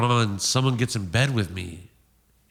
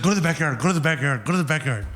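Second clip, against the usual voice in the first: raised, repeated mock-frantic order; urgent panic; 5 semitones higher, faster than usual. Girl sends him to hide in backyard, mock panic. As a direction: mimic her frantic urging, pitch up.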